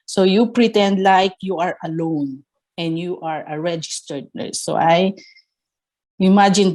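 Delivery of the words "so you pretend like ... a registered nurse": normal talking voice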